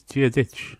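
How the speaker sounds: "Dia Ditch" said as Irish "Dia dhuit", clearly spelled out; Irish greeting. Shown as a demonstration. Teacher demonstrating the Irish hello, careful pronunciation. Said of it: "a very common greeting is".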